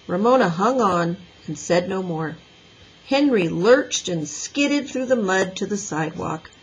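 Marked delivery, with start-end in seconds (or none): none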